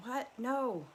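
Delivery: put-on a scared teenager pushing back at dad; defiant teen. Teen refusing dad during fight.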